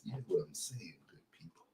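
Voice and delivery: breathy hushed whisper to the flock. Pretend. Preacher whispering to his online congregation. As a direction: lean in and whisper the question.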